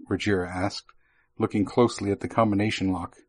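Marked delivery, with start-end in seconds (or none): none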